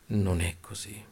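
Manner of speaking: usual speaking voice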